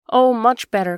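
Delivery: loud, emphatic exclamation; cried out. A narrator's reading. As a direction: cry it out with a falling end. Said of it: "cried the Queen, her voice still rising".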